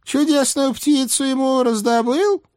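put-on high nagging old hag; old witch voice. A narrator's reading. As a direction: scold like a fussy old witch. Baba Yaga voiced by reader.

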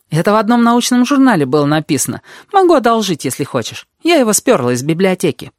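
put-on high-pitched, lively girl's chatter; confident red-haired girl. A narrator's reading. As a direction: chatter on high and sure of yourself.

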